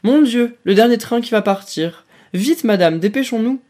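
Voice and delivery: the commander's wife fakes alarm about the last train; feigned hurry. A narrator's reading. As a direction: pretend panic to hurry everyone out. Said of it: "feignant la hâte, s'exclama".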